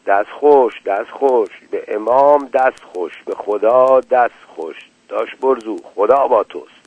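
ordinary delivery

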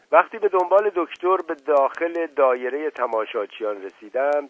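plain reading voice

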